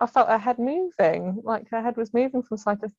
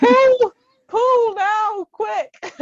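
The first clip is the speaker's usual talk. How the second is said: high laughing urgent demand; urgent laughing plea for the pool; 9 dB louder, much slower than usual. Retold or imitated. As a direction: squeal it through a laugh, much higher. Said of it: "I didn't sound like that at the time".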